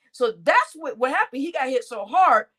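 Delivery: normal talking voice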